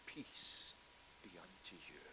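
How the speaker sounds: soft breathy peace, barely voiced; a hushed, tender blessing spoken to frightened disciples; gentle whisper. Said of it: "What did he say to them".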